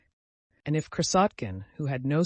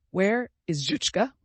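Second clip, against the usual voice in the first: broken voice; 7 semitones higher, 5 dB louder than usual. Acted. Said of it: "asked in a broken voice".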